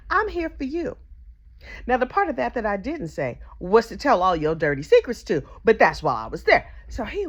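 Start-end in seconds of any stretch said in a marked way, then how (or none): 0.1-1.0 higher, sugary consoling; mock sympathetic. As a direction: lay on fake sympathy. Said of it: "that's what I said to him".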